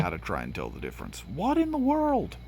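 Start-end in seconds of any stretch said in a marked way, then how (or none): 1.2-2.4 much higher, slow, disbelieving; baffled surprise. Real, not acted. Spotting something strange mid-game, startled and baffled. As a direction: squeak it out in total bewilderment. Sudden odd thing in game.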